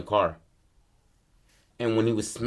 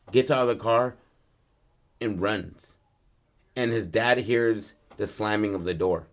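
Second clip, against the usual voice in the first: plain reading voice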